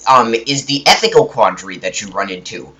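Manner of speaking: ordinary talk, nothing marked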